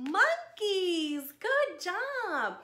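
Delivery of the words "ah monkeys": ordinary delivery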